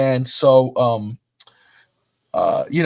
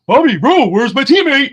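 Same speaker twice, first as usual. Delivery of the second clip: higher and louder, imitating a panicked teammate; mock frantic yell; 9 semitones higher, 6 dB louder than usual. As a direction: yell it like a downed gamer begging for help. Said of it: "Anyway, he's".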